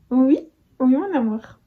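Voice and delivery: doting mother's coo. Real, not acted. Cooing to her baby.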